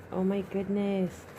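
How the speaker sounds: usual speaking voice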